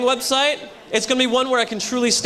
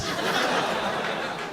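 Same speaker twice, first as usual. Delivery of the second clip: quiet unvoiced laugh at his own joke; breathy laugh; 8 dB quieter than usual. Real, not acted. Comic laughs at his own dark joke, breathy laugh. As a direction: chuckle under the breath.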